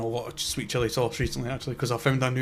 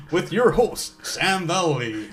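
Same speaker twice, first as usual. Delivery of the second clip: slow, drawn-out mock announcer; announcer intro; 4 semitones higher, much slower than usual. Introducing their podcast, hammed-up slow announcer voice. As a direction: stretch it like a cheesy host.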